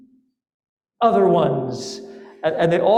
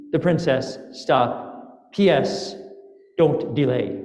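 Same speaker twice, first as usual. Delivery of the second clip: telegram dictation. Acted. Princess's message to the sleeping minister, read as a telegram. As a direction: read a telegram aloud, dropping each "stop". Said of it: "Like a telegram".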